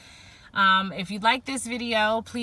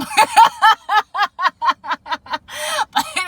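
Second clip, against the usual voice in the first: high laugh; 13 semitones higher, 13 dB louder than usual. Real, not acted. Laughing at her own retelling.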